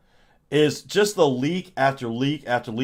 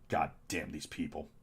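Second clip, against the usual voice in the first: low, much softer grumbled curse under the breath; muttered curse; 10 semitones lower, 17 dB quieter than usual. Retold or imitated. Drunk executive cursing the board, low muttered grumble. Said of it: "He's like".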